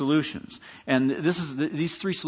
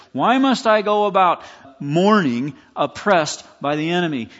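anguished lament; 4 semitones higher, 7 dB louder than usual. Acted. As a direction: cry out the psalm like a man oppressed. Acts out psalmist's lament.

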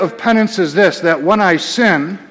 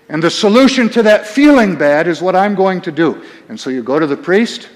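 usual speaking voice

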